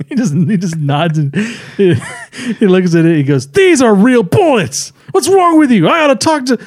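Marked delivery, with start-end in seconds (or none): 3.5-6.6 high-pitched, hammed-up alarm; play-acted shocked complaint; indignant outburst. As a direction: protest in mock outrage at the discovery. Acted shocked outburst over bullets.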